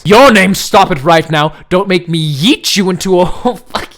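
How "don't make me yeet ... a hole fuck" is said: normal talking voice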